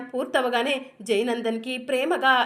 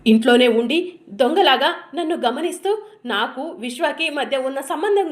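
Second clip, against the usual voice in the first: loud, raised accusing voice; furious accusation; 3 semitones higher, 7 dB louder than usual. Acted. Wife accusing her husband of spying, loud and furious. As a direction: let the anger burst out.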